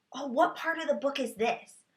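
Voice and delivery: ordinary talk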